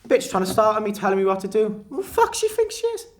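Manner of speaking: loud, rising to a very high shriek; outraged Kev. Retold or imitated. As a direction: rant with outrage.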